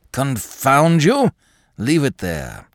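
slow, loud irritable snap; irritable outburst. A narrator's reading. Craven snapping at the butler, loud and irritable. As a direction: bite it out, fed up. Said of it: "said Mister Craven irritably".

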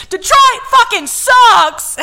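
high, loud, slow drawn-out shout; mock yell. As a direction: holler it out a car window. Dudes yelling from car.

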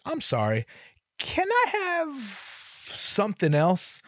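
high, slow sheepish ask; timid apologetic request. Retold or imitated. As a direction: stretch it out sheepishly. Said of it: "I could just be like".

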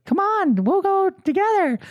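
put-on very high child's coaxing; squeaky mimicked kid voice; little ringleader. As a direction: urge a playmate along like a small girl. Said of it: "be the ringleader".

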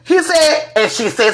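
normal talking voice

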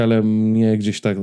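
ordinary talk, nothing marked

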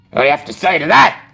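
fast, loud jeering; a hoodlum jeering at the cowering man; thug taunting. Acted. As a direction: sneer it out fast at the victim. Thug confronting.

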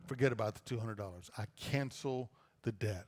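quiet, gentle, letting it go; a store owner forgiving a friend, softly and gently. Acted. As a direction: say it softly and let the debt go. Forgiving the debt, voiced.

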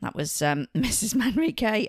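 ordinary talk

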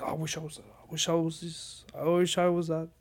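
self-pitying whine. Retold or imitated. A meditator mocking his own self-hating thoughts, whiny. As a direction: whine like someone wallowing in self-pity. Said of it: "being like".

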